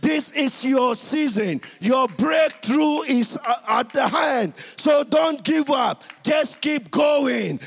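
rising from loud to shouting; building preaching. Acted. Preaching at full force.

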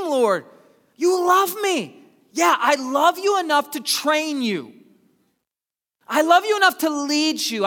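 full-force preaching. Acted. Pastor pleading with God, then voicing God's love, preaching hard. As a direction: cry it out to God.

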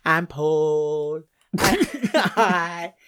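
slow, creepy sing-song madman voice; drawn-out creepy lullaby tone; unhinged villain. Pretend. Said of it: "Talking like a madman and doing sing-song bloody creepy lullabies".

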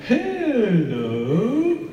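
high, drawn-out clown greeting that hangs; Mister Tumble clown voice. Retold or imitated. Dad imitating son's frozen loading clown greeting. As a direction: start the clown hello, then freeze like it's loading. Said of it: "the loading version".

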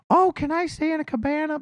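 high, airy mock voice of a fussy guest; mock chatty lady. Mocking a guest at hotel reception, high mock voice. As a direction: mimic the lady hogging the counter.

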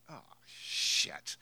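a muttered oh no under the breath; dismayed mother. Retold or imitated. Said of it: "and she's like".

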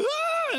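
very high, loud startled yelp; mock frightened squeal; startled kid scream. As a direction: imitate a kid caught in the act. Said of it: "they freak out".

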